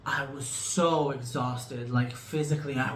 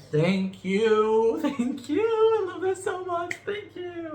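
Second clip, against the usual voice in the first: high, louder laughing gratitude; gushing thanks; 13 semitones higher, 4 dB louder, slower than usual. Real, not acted.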